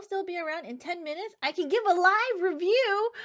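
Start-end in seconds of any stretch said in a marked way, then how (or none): 1.4-3.1 higher and louder eager offer; excited. Real, not acted. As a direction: pitch it with excitement. Offer of live review.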